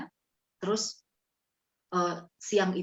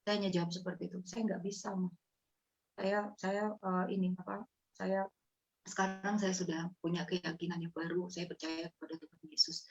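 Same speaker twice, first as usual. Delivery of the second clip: softer, hesitant reply; quiet answer; 8 dB quieter than usual. Retold or imitated. Daughter answers her weeping mother, softly hesitant. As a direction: answer gently, almost afraid. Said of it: "Saya bilang".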